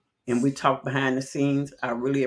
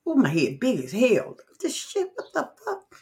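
high squeaky disbelief at her own reflection; shocked at own face; 10 semitones higher than usual. Real, not acted. Streamer seeing herself on camera, shocked high squeal. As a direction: gasp out a startled what-the.

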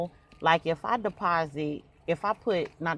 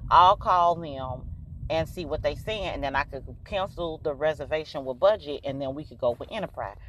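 soothing a worked-up dad, softer; calming; 6 semitones lower, 5 dB quieter than usual. Retold or imitated. Daughter calming her yelling dad on the phone, soft and soothing. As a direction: ease him down gently. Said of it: "the calmer version".

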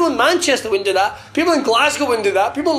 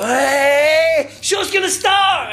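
announcer shout; 6 semitones higher, much slower than usual. Retold or imitated. [name] imitates Simon hyping the show, slow shout. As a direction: belt it out like a ring announcer. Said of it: "walks out to the ring and goes".

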